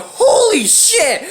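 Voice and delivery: high, drawn-out retold shock; shocked exclamation. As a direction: act out stunned disbelief at a crash.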